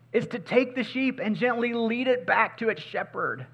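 high, loud pleading preacher; full-force preaching. Acted. Pastor to the church about the lost sheep, preaching at full force. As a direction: preach it out with urgency.

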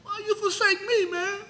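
high-pitched, hammed-up cry of protest; incredulous high-pitched complaint. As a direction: plead upward like you've been abandoned. Voices a cry of being forsaken.

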